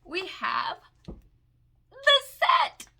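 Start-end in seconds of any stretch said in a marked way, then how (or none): 1.9-2.9 high, loud ta-da announcement; excited high-pitched shout; triumphant reveal. Real, not acted. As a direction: belt it out as a big reveal. Triumphant reveal of the palette set.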